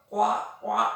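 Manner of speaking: short imitated frog calls; frog croak imitation. Teacher playing the frog for children, imitated croak.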